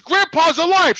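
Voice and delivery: high, loud, indignant announcement; acted outraged disbelief; accusing revelation. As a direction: throw it at your mother, retold. Retold revelation thrown at mother.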